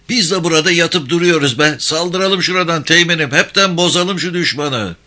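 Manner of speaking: high-pitched, fired-up plea to attack; impatient urging. Acted. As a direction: urge your officer on, pitched high. Impatient soldier urges lieutenant to attack. (sabırsız, tiz sesle kışkırtma)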